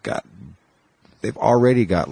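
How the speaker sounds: usual speaking voice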